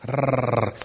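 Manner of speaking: trilled rolling "rrr"; imitated stumbling noise. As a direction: roll your tongue as he topples. Imitates man staggering, falling again.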